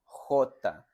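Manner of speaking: J said as "jota" with a harsh throat sound; Spanish letter name J, spoken distinctly. Shown as a demonstration. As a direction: say "J." like a clear "jota". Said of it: "La letra J tiene la siguiente pronunciación".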